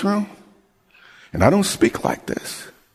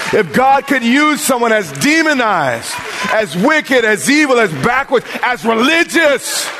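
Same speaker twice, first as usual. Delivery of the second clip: preaching at full force; 7 semitones higher than usual. Acted. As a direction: hammer each question home to the congregation.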